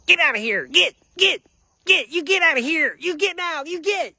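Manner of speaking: high, strained yell, ordering someone off; imitated yelling. Cousin ordering him out after the screaming, imitated high yelling. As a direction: mimic a cousin shooing someone away in shouted bursts.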